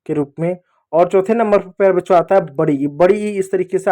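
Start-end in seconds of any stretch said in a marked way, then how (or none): none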